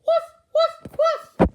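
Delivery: very high, very loud woof woof barks; loud dog barking. A narrator's reading. The dog finding the rabbits, loud yapping barks. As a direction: bark like a big excited dog. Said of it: "The sound of a dog barking".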